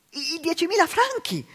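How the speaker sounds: high, loud, aghast question; horrified gasp. Acted. The sisters aghast at the spent francs, high and loud. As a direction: gasp it out in horror. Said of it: "ansimando inorridite".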